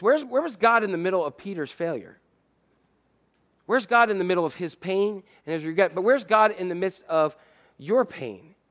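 ordinary delivery

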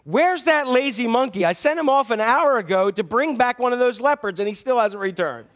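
loud, high staged complaint for the leopard to hear. Acted. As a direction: call out loudly so the leopard overhears. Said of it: "the dog says real loudly".

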